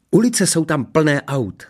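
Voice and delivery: high, slow rapture; gushing. A narrator's reading. Princess raving about the human world, gushing. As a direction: swoon over it, drawn out. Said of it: "rozplývala se Ksenie".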